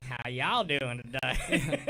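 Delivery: slow, laughing Southern drawl; mock Southern greeting through a laugh; southern accent. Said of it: "hear that southern accent come out".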